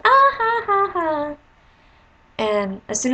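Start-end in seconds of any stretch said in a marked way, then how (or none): 0.0-1.3 very high, loud carefree giggle; a little girl laughing as she twirls; giddy girl's laugh. Retold or imitated. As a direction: laugh like a delighted child spinning. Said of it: "she was just like".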